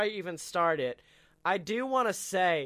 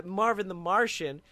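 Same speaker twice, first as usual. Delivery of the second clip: ordinary delivery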